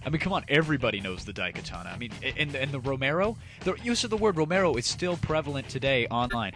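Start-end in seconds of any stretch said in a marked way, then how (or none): none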